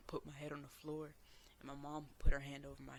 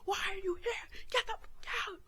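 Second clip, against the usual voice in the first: high, loud, raging yell; shrieking furious demand to leave; furious scream; 8 dB louder than usual. Retold or imitated. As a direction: scream it at him in fury. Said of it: "And I was like".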